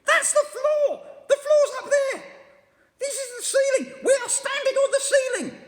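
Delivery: terrified and confused; panicked shriek. A narrator's reading. Missus Twit shrieking that they stand on the ceiling.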